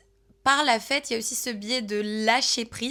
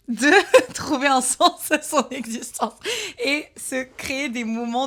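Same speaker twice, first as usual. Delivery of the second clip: laughing; 3 semitones higher, 6 dB louder than usual. Real, not acted. Laughs mid-sentence.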